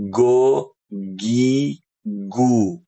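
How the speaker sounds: "Go, gi, gu" with a plain hard G; the mistaken G for ghain; hard g syllables; wrong hard G. Shown as a demonstration. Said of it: "Ini salah".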